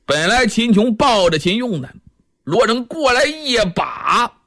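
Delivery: usual speaking voice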